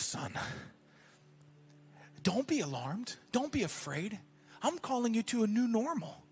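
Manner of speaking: lightly voiced divine counsel, low and soft; Holy Spirit's voice. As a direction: speak softly as God giving a measured answer. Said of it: "I felt like the Holy Spirit said".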